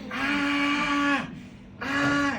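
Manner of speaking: long, high-pitched imitated scream; mock scream. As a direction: imitate a friend's drawn-out cry of pain. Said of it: "I start hearing screaming".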